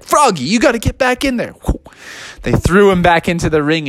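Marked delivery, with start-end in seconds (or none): 0.0-1.5 high, hurried fan shout-out; quick, higher-pitched crowd urging. Acted. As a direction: call out urgently like a fan in the stands. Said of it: "fans were like".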